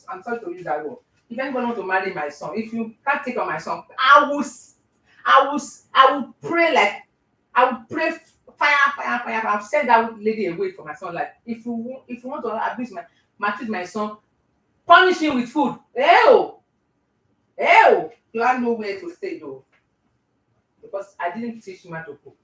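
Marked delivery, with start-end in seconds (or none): 4.0-9.5 loud chant of fire, fire; fiery prayer. Pretend. Mother-in-law praying against a bad wife, loud chant. 16.0-18.1 loud, high disgusted ew. Pretend. Mother-in-law recoiling from bad cooking, loud disgusted ew.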